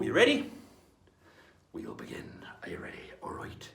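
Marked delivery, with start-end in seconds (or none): 1.7-3.7 softly whispered check before starting. Acted. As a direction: build suspense with a hushed whisper. Host hushes before the song.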